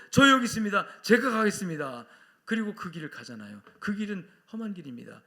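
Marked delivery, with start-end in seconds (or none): 0.1-2.1 loud, high declaration; eager volunteering. Acted. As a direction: shout your offer to go. Volunteer answering God's call. (힘차게 자원하며 외치듯)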